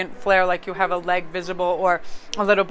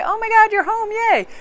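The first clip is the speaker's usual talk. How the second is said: high, louder, gushing greeting; mock delighted welcome; 14 semitones higher, 4 dB louder than usual. Partner welcoming someone through the door, acted excitement. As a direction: squeal a pretend overjoyed hello.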